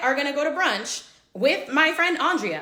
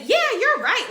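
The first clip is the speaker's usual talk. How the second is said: imitating a gossiping friend, much higher and louder; mock eager agreeing friend; 10 semitones higher, 4 dB louder than usual. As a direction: chime in, hammed-up eager. Friend Brandy piles on, mimicked.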